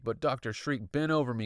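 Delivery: ordinary delivery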